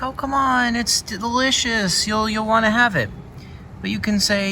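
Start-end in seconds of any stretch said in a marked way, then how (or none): 0.0-3.1 much higher, sweet pleading tone; coaxing villagers. Retold or imitated. Villagers urging the player to stay for dinner, high and sweet. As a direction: coax the guest to eat now. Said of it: "And they'll be like".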